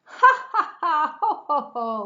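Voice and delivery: very high, loud triumphant laughing; real-sounding giddy laughter; gleeful laughter. A narrator's reading. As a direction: laugh at fooling the wolf. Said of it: "laughed the two little pigs".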